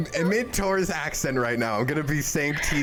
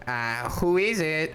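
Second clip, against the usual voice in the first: slow, nerdy voice with a hint of accent; Hutch's nerd voice; 3 semitones higher than usual. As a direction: try on a timid nerd's voice. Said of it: "let me see if I can do a Hutch impression".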